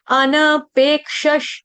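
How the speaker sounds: अनपेक्षश said slowly, syllable by syllable, with a clear क्ष cluster; slow model pronunciation. Shown as a demonstration. Teacher modelling a word for students, slow and careful. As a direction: stretch out अनपेक्षश carefully for the class. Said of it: "देखिए".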